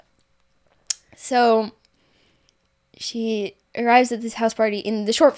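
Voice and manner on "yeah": breathy whispered yeah. Real, not acted. Echoing her friend in a whispered yeah. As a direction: drop to a bare whisper.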